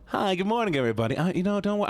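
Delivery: cheery customer service greeting. Pretend. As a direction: fake friendly shop clerk. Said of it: "use my customer service voice".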